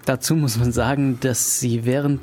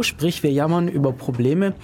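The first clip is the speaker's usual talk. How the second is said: ordinary delivery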